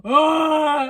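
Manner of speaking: loud imitated gym grunt; overdone shout of effort; mock exertion cry. Showing how she doesn't breathe, mock grunt. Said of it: "I don't go".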